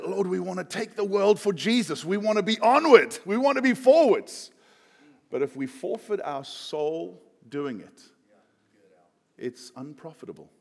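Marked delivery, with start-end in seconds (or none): 0.1-4.5 rising higher and louder, building fervour; zealous prayer. Retold or imitated. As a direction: pray with mounting eagerness. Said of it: "we can say".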